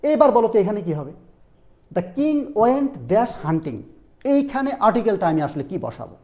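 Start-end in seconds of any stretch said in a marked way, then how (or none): 1.9-3.8 very slow, spaced out example sentence; slow quiz read. Shown as a demonstration. Teacher reading a quiz sentence very slowly to students. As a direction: read the blank sentence word by word. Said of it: "teacher reads quiz sentence slowly".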